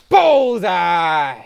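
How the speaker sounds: a loud triumphant cry of the hit; excited shout. A narrator's reading. Narrator cheering the perfect swat, excited shout. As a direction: punch it out like a win.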